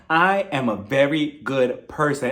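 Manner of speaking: slow, measured self-praise; deliberately paced mock earnestness; mock insistence. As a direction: imitate someone insisting, word by word. Quotes Sutton insisting on herself.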